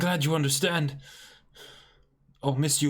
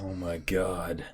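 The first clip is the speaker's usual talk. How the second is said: hushed awe; 10 semitones lower, 6 dB quieter, slower than usual. Real, not acted. Quiet shock.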